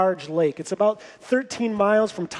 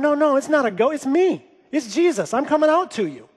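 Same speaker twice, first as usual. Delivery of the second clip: raised, higher-pitched reassuring call; crying out; 5 semitones higher than usual. Retold or imitated. Jesus calling to frightened disciples in the boat, crying out reassurance. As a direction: call out urgently to identify yourself. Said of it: "Jesus cries out".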